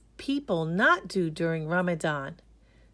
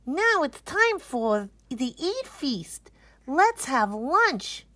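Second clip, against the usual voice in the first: higher, slowed eager announcement; excited exclaim; 7 semitones higher, slower than usual. A narrator's reading. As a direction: announce lunch like a treat. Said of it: "they exclaim".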